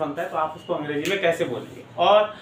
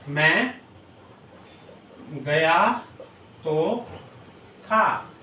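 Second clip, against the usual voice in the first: word by word; 2 semitones lower, much slower than usual. Shown as a demonstration.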